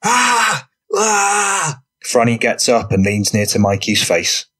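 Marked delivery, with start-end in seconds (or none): 0.0-1.8 high, drawn-out mock cry of pain. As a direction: act out an agonised wail. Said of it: "rolls on the floor holding his knee".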